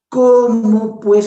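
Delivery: high, loud, slow rebuke; scolding Jesus. Retold or imitated. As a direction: drag out the reproach in disbelief. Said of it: "encima nos reta".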